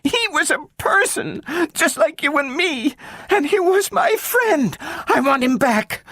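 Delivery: put-on very high upset child voice; girl insisting Robbie was a person; sobbing girl. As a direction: plead through tears. Child protests, crying.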